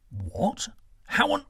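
sharp high burst of disbelief; shocked. Acted. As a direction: cut in startled, way up high. Son shocked by revelation.

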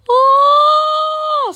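sudden scream, very high and loud. A narrator's reading. Emil hit by cold water, screaming out. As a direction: yelp at the shock of cold water. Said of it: "skriker Emil".

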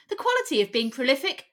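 normal talking voice

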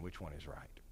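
hushed dismissal. Shown as a demonstration. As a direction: set the question down quietly, unimpressed. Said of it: "Don't ask that question".

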